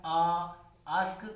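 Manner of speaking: आस्क with a long stretched आ, said slowly. Shown as a demonstration. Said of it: "थोड़ा long जा रहा है". (आस्क में आ को लंबा खींचें)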